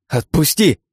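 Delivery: high, loud cry to be released; alarmed demand. A narrator's reading. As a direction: call out sharply in protest. Struggling girl demands release.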